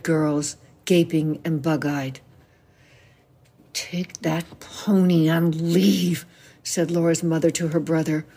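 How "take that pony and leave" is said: slow, hoarse ship's captain; low laryngitic voice. A narrator's reading. Mother dismissing her brother, low hoarse command. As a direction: croak it out very slowly, like laryngitis. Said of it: "voice low, sounding like a ship's captain with laryngitis".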